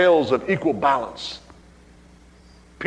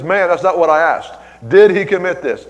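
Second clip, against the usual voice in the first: louder, pressing, indignant; confrontational demand; 5 semitones higher, 8 dB louder than usual. Retold or imitated. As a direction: demand a straight answer, louder. Confronting the evasive secretary.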